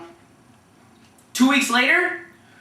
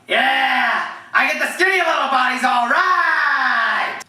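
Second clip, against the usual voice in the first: raised, hollering coach impression; Coach Wolfcool; 4 semitones higher, slower than usual. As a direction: holler it like a coach, dragging the ending. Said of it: "down with Coach Wolfcool".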